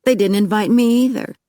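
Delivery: high-pitched indignant protest; affronted, pitch jumping up. A narrator's reading. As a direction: protest at being left out too. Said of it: "Phineas looked indignant".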